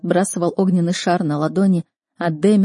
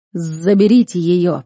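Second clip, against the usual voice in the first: loud, slow command; sharp raised order; 5 dB louder, slower than usual. A narrator's reading. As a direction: order her removed, weighing each word. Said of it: "прикрикнул ректор Таймон".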